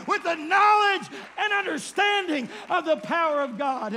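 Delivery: rally them against the devil's plan; full-force preaching. Acted. Preacher rallying the church against the devil, loud preaching.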